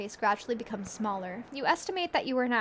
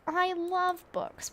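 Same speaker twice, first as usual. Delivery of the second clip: fond book lover; 8 semitones higher, much slower than usual. Acted. Player voicing character fondly.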